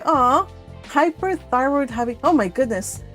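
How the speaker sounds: ordinary talk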